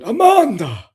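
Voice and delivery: high, loud drawn-out yell of a name; imitating a yell. Mimicking a drunk yelling a name outside, loud high holler. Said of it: "He's just, just, like, going".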